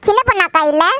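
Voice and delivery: ordinary talk, nothing marked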